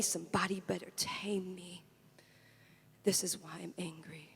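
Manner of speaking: soft, sinking into a low whisper; fading to whisper. Acted. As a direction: drop away to a bare whisper. Refrain drops to a whisper.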